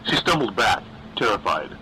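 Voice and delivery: ordinary delivery, nothing marked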